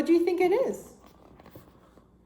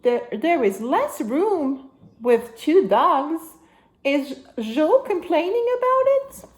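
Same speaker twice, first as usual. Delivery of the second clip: put-on reading to a toddler; 4 semitones higher, 3 dB louder than usual. Reading picture book to child.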